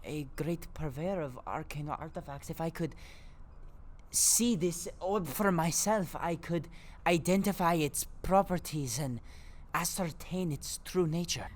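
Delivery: a nervous merchant reassuring a friend; shopkeeper Orla voice. Acted.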